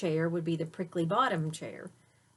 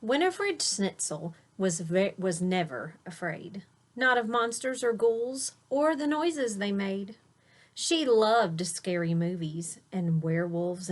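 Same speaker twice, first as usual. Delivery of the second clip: put-on lilting rhyme read to a child; read-aloud storytelling; 5 dB louder than usual. A narrator's reading. Parent reading a rhyming bedtime book aloud to a child. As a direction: read the verse with a gentle bounce.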